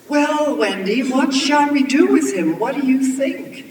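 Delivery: high, loud, deliberate question; grandma calling down. A narrator's reading. Grandma asking the girl about his fate, calling down. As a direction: project the question down to the child.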